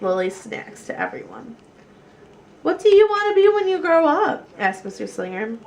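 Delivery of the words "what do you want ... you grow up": higher, louder cheery question; lively teacher. A narrator's reading. Mister Slinger asking the class, cheery and loud. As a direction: ask the class brightly.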